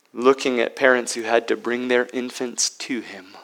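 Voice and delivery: usual speaking voice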